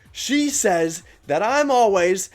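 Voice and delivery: high, slow, drawn-out yell mimicking an angry customer; mock a worked-up customer's loud rant; imitated customer yelling. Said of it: "he's actually yelling at me".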